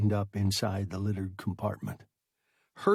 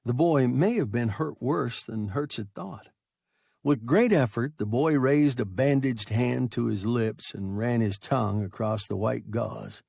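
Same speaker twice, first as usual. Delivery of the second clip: ordinary delivery, nothing marked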